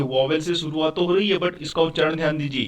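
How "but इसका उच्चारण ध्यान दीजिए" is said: usual speaking voice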